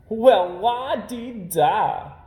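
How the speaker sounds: slow, high, loud sarcastic la-dee-da; mocking. A narrator's reading. Too Tall sneering at Brother for defending Milton, mocking. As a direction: drag it out in scorn.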